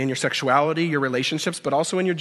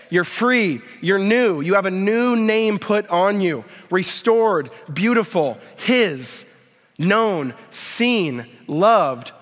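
fervent preaching; 3 semitones higher, slower than usual. Acted. Pastor to congregation, fervent proclaiming of freedom. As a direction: proclaim each word like a declaration.

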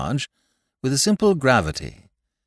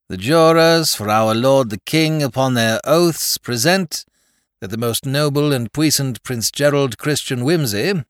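formal court proclamation; 5 semitones higher, 4 dB louder than usual. A narrator's reading. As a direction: read it out like a court clerk. Reads the formal indictment.

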